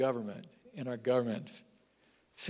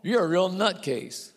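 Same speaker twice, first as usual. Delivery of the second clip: scornful governor; 6 semitones higher, 3 dB louder, slower than usual. Acted. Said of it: "One translation says".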